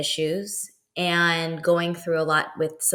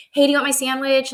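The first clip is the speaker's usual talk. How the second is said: chirpy offer; 7 semitones higher, 4 dB louder than usual. Retold or imitated. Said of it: "And I was like".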